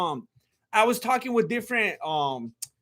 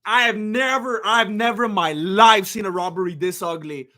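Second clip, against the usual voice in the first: ordinary delivery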